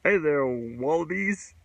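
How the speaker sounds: slow, hammed-up Australian accent; jokey mock Australian accent, drawn out; a playful Aussie impression in a slow put-on Australian accent. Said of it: "I'm gonna do my Australian accent".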